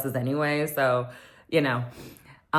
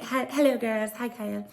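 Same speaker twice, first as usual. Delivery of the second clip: dry hi; 8 semitones higher than usual. Retold or imitated. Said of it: "just gave her a dry hi".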